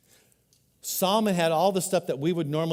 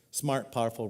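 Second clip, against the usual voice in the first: normal talking voice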